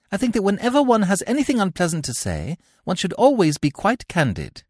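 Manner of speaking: put-on a touch of girlish politeness; lightly voiced young girl. Cecily voiced in read play.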